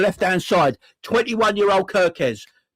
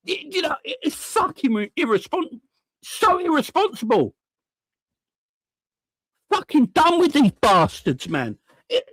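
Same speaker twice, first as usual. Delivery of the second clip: higher, stammering exasperation; exasperated outburst; 8 semitones higher than usual. Real, not acted. Fan fed up with his midfielder, exasperated and higher. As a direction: voice cracking up high in disbelief.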